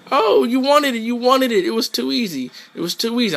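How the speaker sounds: mock the loser in character; taunting victory lines.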